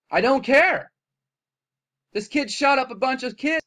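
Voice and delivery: loud, high, defiant snap; angry. Real, not acted. Vlogger ranting at critics, high loud shout. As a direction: fling it out at the viewers. Angry rant about shooting.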